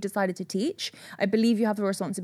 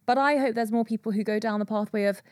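ordinary delivery

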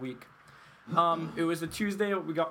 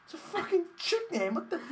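mock outrage; 13 semitones higher than usual. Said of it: "He goes".

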